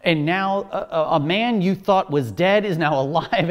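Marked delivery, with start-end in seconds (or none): none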